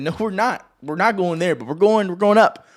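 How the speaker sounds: insistent pushback in a retold argument; defiant retort. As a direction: argue back, rallying upward. Acted defiant reply to influencers.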